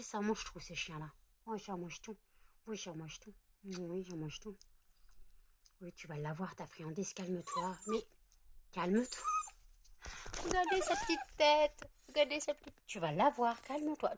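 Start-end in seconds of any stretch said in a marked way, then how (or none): 1.5-9.2 softer, coaxing the dog to calm down; soft pet talk. Real, not acted. To the dog waiting for her treat, soft soothing pet talk. 10.4-14.2 higher, gushing over her little face. Real, not acted. To viewers about the dog's little face, high cutesy gushing.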